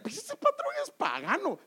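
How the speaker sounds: a hint of smug disdain; mock scoffing. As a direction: put on a dismissive believer looking down on the boss. Churchgoer scoffing at boss.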